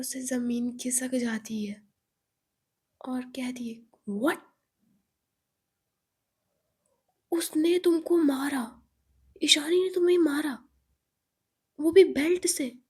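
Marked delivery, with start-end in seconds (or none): 4.1-12.8 loud, high disbelieving cry; shocked outburst. Acted. Friends shocked that Eva was beaten, loud disbelief. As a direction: gasp it out in horror.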